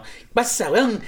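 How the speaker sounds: high, sing-song Chilean "¿Qué pasa, weón?"; imitating a Chilean; Chilean accent. Shown as a demonstration. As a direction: toss it off like a Chilean buddy. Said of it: "lo de ellos".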